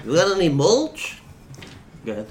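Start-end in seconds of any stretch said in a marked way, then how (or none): 0.1-1.2 high, cartoonish duck voice; duck from the duck song. Joker asking friends for mulch, duck song voice. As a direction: pitch it up like a pestering cartoon duck. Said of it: "You sound like the duck".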